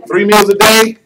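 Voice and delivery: high, loud, laughing disbelief; the wife scoffing at three meals; incredulous question. Retold or imitated. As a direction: laugh it off as absurd. Wife questions husband's list.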